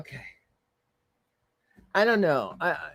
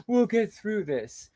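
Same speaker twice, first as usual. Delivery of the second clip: sarcastic sing-song mimicry; mock her fake-solemn line; parroting her line with scorn; mocking quote; 5 semitones higher, slower than usual. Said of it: "We who?".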